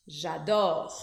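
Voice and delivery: j'adore said as one word, no E before adore; French j'adore. Shown as a demonstration. Teacher models the elision j'adore for students. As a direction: glide j'adore together, zha-dor. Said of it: "Então, ficaria".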